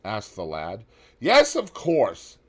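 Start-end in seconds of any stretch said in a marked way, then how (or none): 1.2-2.4 high, loud, slow gentleman's voice; Lord Henry. A narrator's reading. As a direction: draw it out high and easy. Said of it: "answered Lord Henry".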